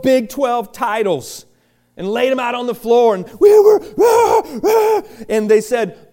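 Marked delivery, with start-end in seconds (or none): 3.4-5.0 excited cheer. Retold or imitated. Twelve titles laid on floor.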